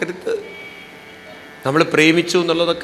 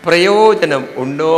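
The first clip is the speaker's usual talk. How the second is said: slow and loud, leaning on പ്രയോജനം; rhetorical challenge; 6 dB louder, slower than usual. Real, not acted. Speaker quizzing the audience, slow loud rhetorical question. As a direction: fire the question at the crowd slowly.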